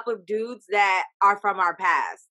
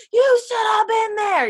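much higher and louder shout; yelling at TV; 13 semitones higher, 6 dB louder than usual. Retold or imitated. Fan yelling at Nathan on her TV, shouting. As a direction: yell it at the screen. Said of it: "I'm just yelling at my T V".

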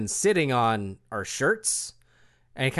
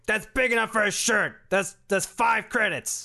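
higher, fast matter-of-fact seller; Borkat voice; 7 semitones higher than usual. Acted. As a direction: rattle off the price. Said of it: "It's like".

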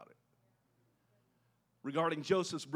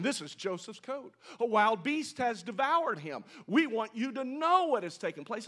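louder, firm declaration of the death; blunt statement; 6 semitones higher, 5 dB louder than usual. Shown as a demonstration. Preacher contrasting a blunt announcement the brothers never made. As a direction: state it flatly as fact, no hinting. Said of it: "They're not saying".

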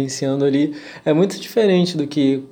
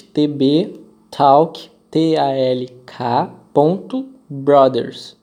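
slow, letter by letter; spelling it out; slower than usual. Shown as a demonstration. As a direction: spell each letter out slowly. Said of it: "vou soletrar pra vocês".

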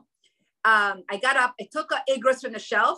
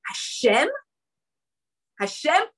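ordinary delivery

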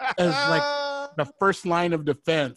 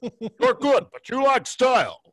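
kung fu movie voice; 7 semitones higher, 4 dB louder than usual. Pretend. Kung fu movie dubbed line.

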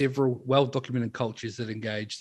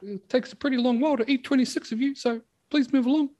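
high polite cannibal voice; 13 semitones higher, 4 dB louder than usual. Retold or imitated.

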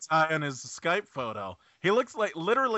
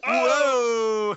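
high, loud whoa; excited whoa; 9 semitones higher, 9 dB louder than usual. Real, not acted. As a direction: whoop in delight. Reacting to a crude joke.

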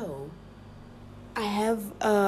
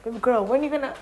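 calling out; 5 semitones higher, 5 dB louder than usual. Real, not acted. Calling to partner for pan.

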